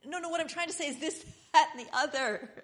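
frustrated second try, high and fast with a laugh; exasperated insisting. Retold or imitated. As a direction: push harder, half laughing at yourself. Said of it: "and you're like".

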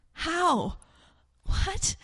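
high, hushed bewilderment; bewildered captive. Retold or imitated. Captives hear they are free, bewildered. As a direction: ask it in stunned little questions. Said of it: "you're sitting there going".

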